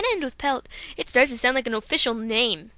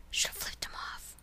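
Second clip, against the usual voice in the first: whispered aside; 15 dB quieter than usual. Real, not acted. Muttered aside about honking driver.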